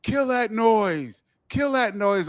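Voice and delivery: drawn-out mock crowd chant; stretched-out heckle, retold; heckling crowd. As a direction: imitate a crowd jeering a performer. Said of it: "People in the crowd start going".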